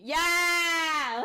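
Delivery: hyped-up celebratory whoop; excited cheer. Real, not acted. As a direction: cheer it out long. Cheering along with the chat.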